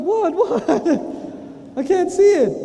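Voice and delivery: high, loud panicked "what? what?"; high, loud alarm over a bad report you can't see. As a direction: yelp like you just heard bad news behind your back.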